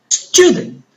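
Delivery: Student with STU high and long; Student, STU-dent, teacher's exaggerated stress; stressed first syllable. Shown as a demonstration. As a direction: punch the first syllable of Student, let the end drop. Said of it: "going to pronounce louder and longer".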